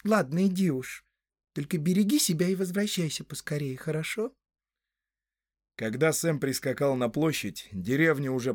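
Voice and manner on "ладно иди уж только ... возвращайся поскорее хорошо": put-on high girlish voice, softening to a rising question; Rosie, tender. A narrator's reading. Rosie seeing sweetheart off, high tender voice. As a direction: send him off with gentle concern.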